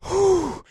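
loud, high exhale of relief; relieved whew. Retold or imitated. Art bent over after landing, a loud high relieved whew. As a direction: blow it out like you just cheated death.

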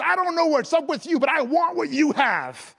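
high, loud imitation, slowing at the end; eager amazement. Young clerk wanting what the shopper has, eager outburst. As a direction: burst out with longing, stretch the last words.

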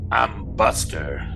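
slow would-be tough small dog; a tiny dog acting tough; tough guy voice. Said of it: "Buster poses in his best big tough guy pose".

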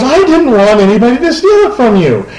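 teasing excuse. Retold or imitated. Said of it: "he goes, he goes".

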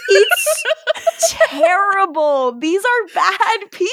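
high, laughing wail of protest; laughing outrage. Real, not acted. Host reacting to friends shutting Luke out, laughing outrage. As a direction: draw out terrible, high and helpless with laughter.

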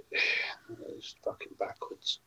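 breathy muttered disgust; exasperated whisper. Real, not acted. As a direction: mutter it under your breath.

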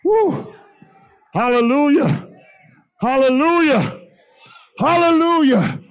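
ordinary delivery

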